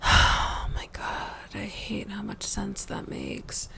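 slow sighing whisper of reluctant acceptance; hushed, resigned groan to oneself; exasperated whisper. Acted. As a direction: breathe it out in dismay. Reluctantly accepts the absurd logic.

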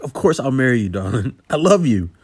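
loud, high, overjoyed yes; joyful shout. Acted. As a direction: burst out with happy disbelief. Accepting proposal overjoyed.

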